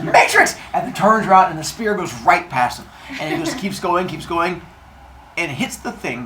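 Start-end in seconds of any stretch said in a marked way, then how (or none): 0.0-0.6 very high, louder cry; Matrix dodge cry. Retold or imitated. Brother dodging spear, high excited cry. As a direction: shout it while dodging like the movie. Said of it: "And he says".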